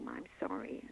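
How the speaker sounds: hushed, sheepish whisper; retold wife whispering sorry to her husband; whispered apology. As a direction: breathe the apology out almost unvoiced. Wife's retold confession to husband.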